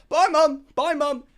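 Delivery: high, loud, sing-out farewell; calling out goodbye. Acted. Son leaving for the airport, calling out goodbye.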